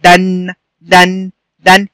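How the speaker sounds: short a in دن, then hum the n; fatha tanween. Shown as a demonstration. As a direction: say دن as "dan" with a held n. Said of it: "تبقى إيه؟". (نطق دن "دَنْ" بنون ساكنة)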